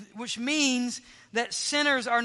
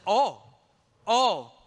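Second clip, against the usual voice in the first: "all" punched out loud, each time alone; a forceful, isolated "all", repeated; stress on all; 4 dB louder than usual. Shown as a demonstration. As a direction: hit each "all" hard with a pause between. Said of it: "That's the emphasis".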